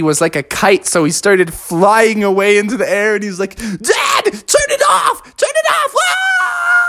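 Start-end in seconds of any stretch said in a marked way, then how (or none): 3.6-6.8 very high frantic yell; panicked Froggy. Acted. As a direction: scream for Dad to switch it off. Froggy blown away like a kite.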